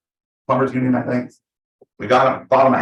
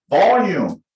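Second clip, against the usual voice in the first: punched loud and higher; loud demonstration; 7 semitones higher, 5 dB louder than usual. Shown as a demonstration. Coach announces the next topic, volume, loudly. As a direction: call the word out loudly.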